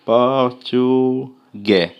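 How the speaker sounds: "portugais" drawn out very slowly, silent final s; teacher models the word slowly; slow French word. Shown as a demonstration. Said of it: "Je suis".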